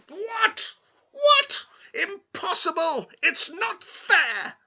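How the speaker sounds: very high, loud furious shriek; screeching angrily. Acted. Little man whose name was guessed, furious high screech. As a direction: shriek in outraged disbelief. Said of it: "screeched angrily".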